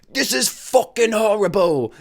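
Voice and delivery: mock chef slowly sneering at the dish; Gordon Ramsay insult. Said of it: "instead of being like".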